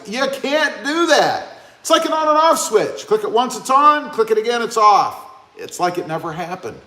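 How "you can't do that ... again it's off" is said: mock explaining. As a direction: lay out the obvious rule with mock patience. Mock explaining the thumbs-up rules.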